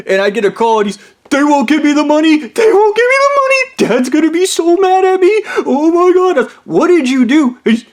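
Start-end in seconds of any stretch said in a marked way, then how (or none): 1.3-2.4 high whimpering complaint; mock high whimper; whiny panic. Blondie panicking on phone. 2.4-3.6 very high wailing repeat; mock high squealed wail; shriller panic. Blondie panicking on phone.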